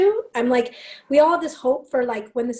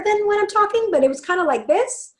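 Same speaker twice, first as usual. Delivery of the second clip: put-on old higher voice; 8 semitones higher, 3 dB louder than usual. Shown as a demonstration. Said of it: "my voice was, like, even higher".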